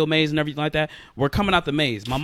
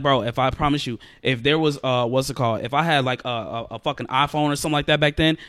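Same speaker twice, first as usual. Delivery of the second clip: normal talking voice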